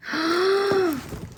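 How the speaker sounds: sharp high delighted gasp; a high little intake of delight. Real, not acted. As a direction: gasp at the reveal. Seeing the warmer.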